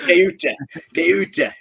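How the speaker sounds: hammed-up Lancashire, Ceuta said KEW-ta; jokey Lancashire accent, Ceuta with a K; mock Lancashire accent. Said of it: "if you want to say it with a Lancashire accent".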